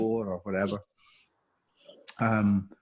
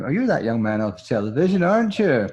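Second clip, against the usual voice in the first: put-on Welsh accent; 2 semitones higher, 5 dB louder than usual. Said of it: "that was my Welsh accent that time".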